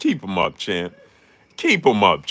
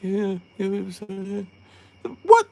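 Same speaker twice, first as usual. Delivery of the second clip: mock Robert Garcia; 12 semitones higher than usual. Freddie Roach walking over to Robert Garcia, high and mumbling. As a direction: fumble the words, then confront in a high voice. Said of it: "He came over there like".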